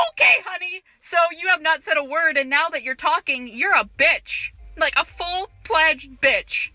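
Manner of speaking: loud, high, emphatic comeback; indignant retort. Retold or imitated. Cashier fed up with a rude customer, indignant.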